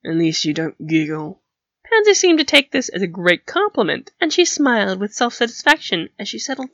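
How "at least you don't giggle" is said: lightly voiced gruff captain; a touch lower, grudging; understated low grunt of a line; grunting Flint. A narrator's reading. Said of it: "Flint grunted".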